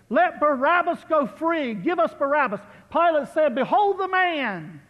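fervent pulpit recitation; preached scripture. Acted. Preaching the trial scene.

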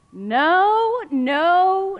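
high, loud drawn no; emphatic no. Acted. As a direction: land each no hard. Baby won't sleep, dramatic.

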